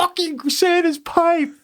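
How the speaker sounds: high, drawn-out pretend astonishment; mock amazement. Dad imagining daughter spotting Santa's pipe, mock amazement. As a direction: exclaim slowly in hammed-up wonder.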